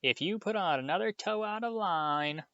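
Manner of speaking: shrill mocking mother. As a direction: ape a nagging mother to mock him. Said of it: "He put on a shrill, piercing voice".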